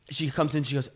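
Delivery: ordinary talk, nothing marked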